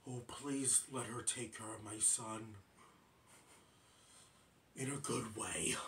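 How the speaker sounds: low, soft, hushed plea, sinking to a whisper; Great Prince pleading. Retold or imitated. The Great Prince asking Dave to let her babysit, low and hushed. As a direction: murmur it low, almost begging. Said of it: "would then say".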